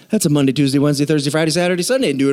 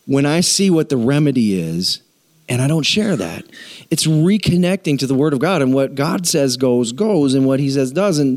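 ordinary talk

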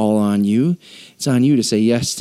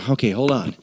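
ordinary talk

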